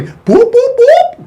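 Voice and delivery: high whooped siren blips; imitated police siren. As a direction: whoop like a cop car siren. Imitates police siren pulling him over.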